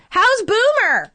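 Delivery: high, drawn-out heckler call; imitated audience shout. Woman shouting from the theater audience, mock holler. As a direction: call it out slowly from the crowd, imitating a fan. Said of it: "this woman shouts".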